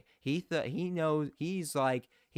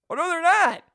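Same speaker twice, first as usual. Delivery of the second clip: high, loud burst of disbelief; surprised outburst; 9 dB louder than usual. Real, not acted. Commentator shouting when refs skip the review. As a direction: blurt it out, shocked.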